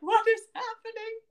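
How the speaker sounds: confused crowd. Retold or imitated. Crowd reacting to chaos.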